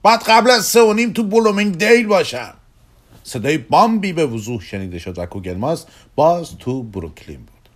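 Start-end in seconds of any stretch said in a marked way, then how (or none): 0.0-2.5 high, loud call to someone far away; shouting. Acted. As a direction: holler it urgently. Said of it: "داد زد".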